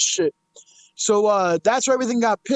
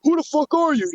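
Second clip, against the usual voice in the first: higher retold challenge, falling at the end; E B Z retort; 6 semitones higher than usual. Streamer voicing the man's reply to a stranger, retort. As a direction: throw the question back, pitched up and dropping on "you". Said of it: "E B Z goes".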